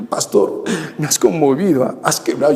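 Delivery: hushed, breathy parody of a moved listener; mock tearful congregant. Pastor mocks a sobbing congregant, hushed weepy voice. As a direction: whisper like someone overcome with emotion. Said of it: "contándote historias desgarradoras donde".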